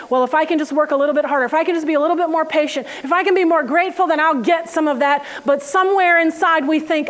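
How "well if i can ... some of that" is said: fast, rushed bargaining; fast striving inner monologue; anxious inner voice. Retold or imitated. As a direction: rattle it off quickly. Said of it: "We always want to work for it".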